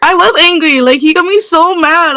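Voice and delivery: playful re-enacted fury; angry on request. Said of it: "you need to do the emotion that you had behind it".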